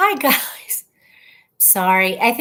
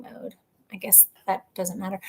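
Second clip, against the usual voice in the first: whisper; 3 semitones lower, 12 dB quieter than usual. Real, not acted. Aside about airplane mode.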